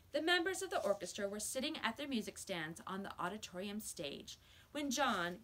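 plain reading voice

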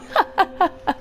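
high staged laugh; put-on laughter. Player after telling viewers old news, put-on laugh.

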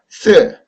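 스 falling sharply from high; fourth-tone demo. Shown as a demonstration. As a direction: drop 스 steeply in Mandarin fourth tone. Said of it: "근데 사 성이에요".

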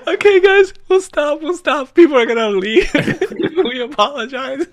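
giggling while talking; laughing. Real, not acted. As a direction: laugh through the words. Laughing through apology.

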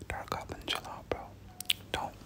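normal talking voice

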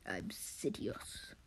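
hushed, slow, low villain voice; mock sinister reveal; Sidious. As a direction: whisper it slowly and darkly.

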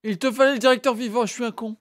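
angry shout. Real, not acted. Angry at his own mistake.

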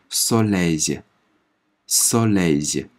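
soleil drawn out very slowly; slow French demo. Shown as a demonstration. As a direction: model soleil slowly for the class. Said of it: "字母O发O的音". (极慢示范读soleil)

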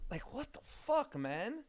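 high, slow disbelieving what the fuck; disbelief. Real, not acted. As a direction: stretch it out in shock. Disgusted at dog.

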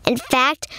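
ordinary delivery, nothing marked

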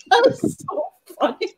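ordinary talk, nothing marked

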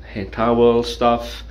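ordinary talk, nothing marked

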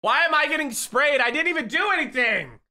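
high, loud protest of innocence; outraged whine about being sprayed; indignant yelp. Real, not acted. Complaining about getting sprayed.

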